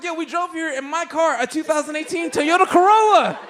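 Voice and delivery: high, loud mock-boastful reply; sarcastic pride. Comic sarcastically defending his Corolla, high mock boast. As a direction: brag about the car with irony.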